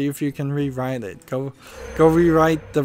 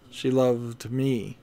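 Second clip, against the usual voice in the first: loved leaned on, dropping, slow and softer; stress on loved; 3 semitones lower, 5 dB quieter, slower than usual. Shown as a demonstration. Showing past love by stressing loved, softly. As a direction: weigh loved like it's in the past. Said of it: "as in she used to love me".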